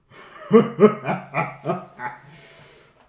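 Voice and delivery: loud, booming villain laugh echoing out; evil cackle of the villain over the valley; dark laugh. Acted. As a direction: let a dark laugh roll through the valley. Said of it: "you can hear that dark laugh".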